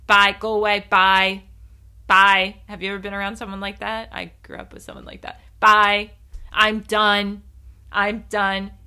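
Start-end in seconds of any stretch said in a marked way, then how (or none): 0.1-2.6 loud, curt brush-off on a level pitch; acting out a triggered person cutting someone off; triggered dismissal. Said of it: "they're triggered and they just go". 5.6-8.7 loud, level-pitched cut-off; mock walk-out, each phrase set apart; triggered dismissal. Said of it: "they're triggered and they just go".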